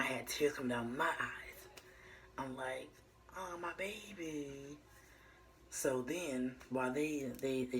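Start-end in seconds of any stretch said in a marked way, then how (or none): 3.4-4.8 put-on soft, slow, higher, moved "oh my baby"; tender coo. Mother hearing her firstborn cry, softly cooing. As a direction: coo it like seeing your newborn.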